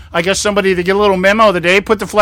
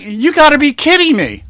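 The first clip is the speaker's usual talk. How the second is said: incredulous; 8 semitones higher than usual. Retold or imitated. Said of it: "I'm like".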